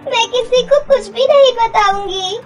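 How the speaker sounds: high, crying plea for mercy; tearful pleading. Acted. Girl begs stepmother not to throw her out, crying.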